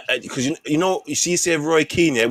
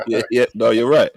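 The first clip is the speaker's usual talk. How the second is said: normal talking voice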